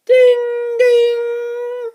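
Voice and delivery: high loud ringing ding ding; a high bell call for the kids; train bell ding. Acted. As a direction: ring it like a bell.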